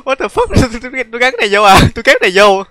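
high-pitched, incredulous laughing outburst; startled and laughing; amused disbelief. Real, not acted. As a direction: blurt it out high, cracking up in disbelief. Giật mình cười khi xem lại video.

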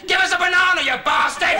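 loud, high-pitched yell; jeering shout. Retold or imitated. People in the dream pointing at Kevin, jeering shout. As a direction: holler a rude demand like a crowd. Crowd yells at him in dream.

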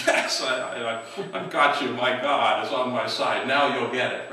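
loud gloating victory cry; triumphant gloat. Acted. Elijah crowing at the rival prophets, loud triumphant gloat. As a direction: crow over the beaten rivals. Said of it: "you can imagine Elijah".

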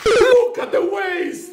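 dismayed cry. Acted. Dismayed at wasted eggs.

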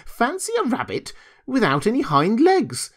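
high, swooping mockery; calling out. A narrator's reading. Wild rabbit mocking the toy's missing legs, amused jeer. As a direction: crow it in amazement, pitched well up. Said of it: "he called out".

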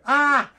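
loud, high yelp of alarm; mock shriek on waking in fright; startled scream. Waking to a mouse at night, shrieking.

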